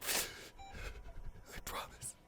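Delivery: slow, breathless whisper; whispered promise. Acted. Partner whispering a final promise to a sleeping love. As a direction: whisper the vow close and slow. Whispered vow after loud one.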